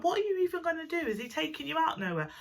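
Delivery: high-pitched imitated grilling; disbelieving friend. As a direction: mimic a friend's incredulous questions up high. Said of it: "And she's like".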